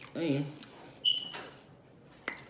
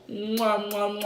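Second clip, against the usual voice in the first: kiss sounds; 3 semitones higher than usual. Real, not acted. Blowing kisses to viewers.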